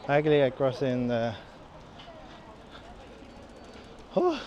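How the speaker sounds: breathing hard between words; winded from pushing uphill. Real, not acted. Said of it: "Sorry for the deep breathing".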